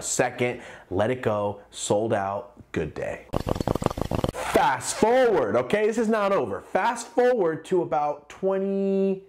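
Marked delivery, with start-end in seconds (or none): 4.3-9.2 dramatic announcement. Real, not acted. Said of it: "dramatic announcement to viewers".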